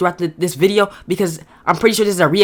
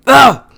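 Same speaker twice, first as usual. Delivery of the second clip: loud, raised groan of disgust; exasperated groan; 5 semitones higher, 7 dB louder than usual. Real, not acted. Reviewer fed up with rewatching a bad movie, exasperated groan. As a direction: let out a fed-up "ugh".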